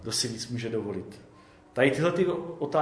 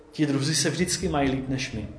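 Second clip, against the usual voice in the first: ordinary talk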